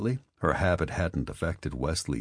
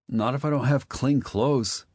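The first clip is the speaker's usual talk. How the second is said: put-on teen boy voice; 10 semitones higher, 4 dB louder than usual. Wesley's boy voice.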